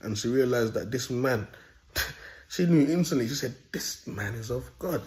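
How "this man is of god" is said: slow, hushed realization; imitated inner thought. Woman realizing something about him in her head, hushed and slow. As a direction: say it softly, as if thinking it. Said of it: "She said it in her head".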